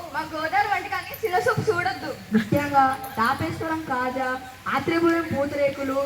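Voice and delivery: ordinary delivery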